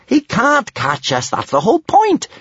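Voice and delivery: high, gleeful retort; high-pitched, cocky delight; grinning. A narrator's reading. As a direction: say it through a big grin. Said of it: "grinned Kamikaze".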